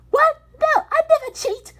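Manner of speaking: very high, loud indignant cry; objecting. Acted. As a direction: protest the accusation loudly. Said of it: "Six objected".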